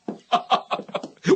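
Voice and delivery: short unvoiced laugh; breathy chuckle. Congratulating a stunned judge.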